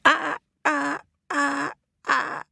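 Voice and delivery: high wailing cries; crying. Acted. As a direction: wail in distress. Vulture cries, bald.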